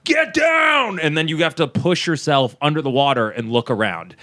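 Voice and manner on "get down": high, drawn-out mock shout; imitated yell. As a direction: call divers under like the crew. Crew yelling at divers, retold.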